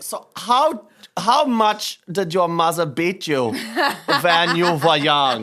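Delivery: hammed-up therapist question; mock Freud therapist. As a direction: play a probing psychiatrist. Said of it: "You'll be Sigmund Freud".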